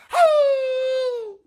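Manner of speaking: long, very high dog howl; imitated dog howl. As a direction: howl like a dog in pain, one sustained high note. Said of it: "howling, screaming".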